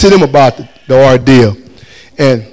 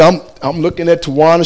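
ordinary delivery

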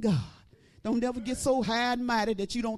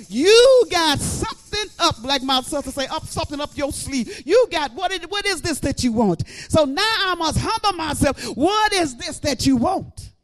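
full-force preaching; 4 semitones higher, 9 dB louder than usual. Acted. Preacher crying out to God before the church, loud. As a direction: preach it up to the rafters.

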